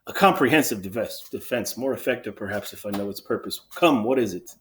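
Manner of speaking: mildly impatient, dry royal reply; a touch of irritation, prodding for the point; annoyed. Acted. King answers the sentry's excuses, mildly annoyed. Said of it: "starts to get annoyed".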